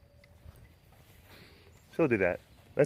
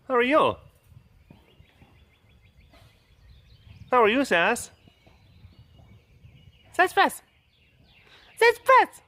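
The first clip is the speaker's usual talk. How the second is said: very high, loud squeal of hello; excited pet greeting; 11 semitones higher, 7 dB louder than usual. Real, not acted. Greeting Sass the pet, excited high baby-talk.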